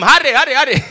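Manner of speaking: loud, high, hurried demand; impatient urging. Acted. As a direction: rush them along loudly. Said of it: "you were busy telling them".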